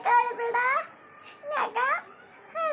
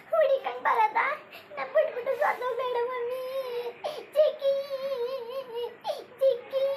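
put-on higher, childlike pleading; a small child begging mum not to cry; little child voice; 5 semitones higher than usual. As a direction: innocent child's voice.

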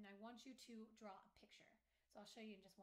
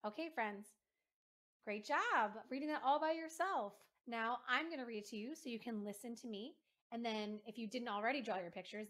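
much louder, lively teacher address; louder, upbeat teacher reading aloud to children; bright video lesson voice; 14 dB louder than usual. Acted. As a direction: project to the class like a lively teacher.

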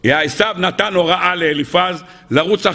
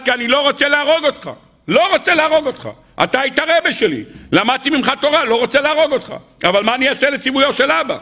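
high, anguished voice of a reluctant killer; retold tearful pleading; 7 semitones higher than usual. Nephew tells his uncle he cannot kill him, retold tearful plea. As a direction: plead helplessly, imitating someone crying. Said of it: "למה אתה בוכה".

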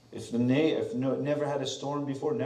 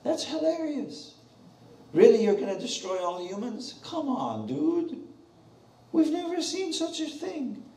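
high, slow, scoffing disbelief; mock the warning as a joke; sneering high-pitched ridicule; mocking scoffer; 10 semitones higher than usual. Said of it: "Laugh at it".

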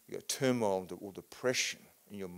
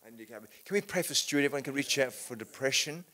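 ordinary delivery

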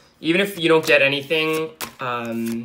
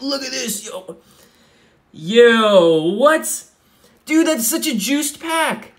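frat bro; 8 semitones higher, 4 dB louder, much slower than usual. Real, not acted. Hyped reaction to pull.

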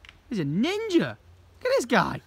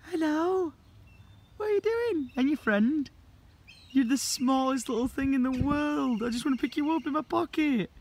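adoring, high pet-talk; cooing; 5 semitones higher than usual. Real, not acted. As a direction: coo sweetly, pitched up. Cooing at a tiny animal.

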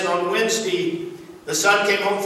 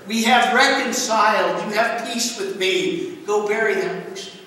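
a father's voice pitched higher, pleading with his son; urging father; 4 semitones higher than usual. Retold or imitated. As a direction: coax the son like an exasperated, loving dad. Father urging son in retold story.

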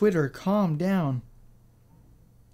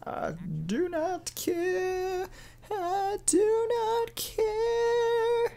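much higher, slow, soft fake-crying wail; mock wailing; 8 dB quieter, much slower than usual. Gamer hamming up heartbreak over Yokai Watch, mock wailing. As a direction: melodramatic sob stretched out high. Repeats line, then wails it.

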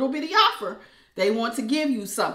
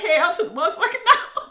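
much higher, louder, laughing flat refusal; sassy refusal; 9 semitones higher, 5 dB louder than usual. Pretend. Reader voicing Aquarius turning down the ex. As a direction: toss it off with attitude. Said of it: "y'all like".

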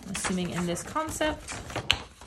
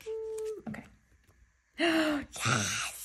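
ordinary talk, nothing marked

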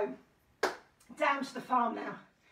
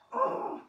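high hee-haw; squeaky hee, then haw; donkey bray; 11 semitones higher than usual. Pretend. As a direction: imitate a donkey for children. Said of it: "the donkey makes a noise like this".

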